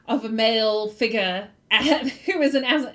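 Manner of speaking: usual speaking voice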